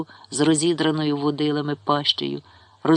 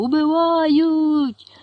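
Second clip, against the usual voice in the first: much higher, louder, very slow wail; desperate drawn-out scream; 13 semitones higher, 6 dB louder, much slower than usual. A narrator's reading. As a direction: howl it across the field. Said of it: "закричав Павло".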